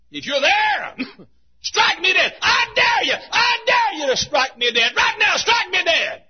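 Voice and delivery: high, loud taunting shout; defiant dare. Acted. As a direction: dare God to his face. Atheist daring God to strike.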